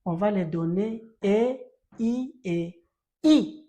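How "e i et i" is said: teaching aloud. Shown as a demonstration. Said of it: "teacher listing vowels".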